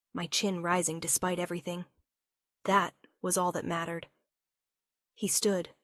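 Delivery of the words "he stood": ordinary delivery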